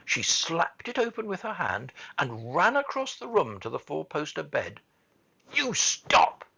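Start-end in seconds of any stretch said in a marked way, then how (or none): 5.5-6.5 high, loud, furious command; almost shouting. A narrator's reading. Furious girl ordering the screaming boy, almost shouting. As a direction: cut him off with a high shout. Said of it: "she almost shouted".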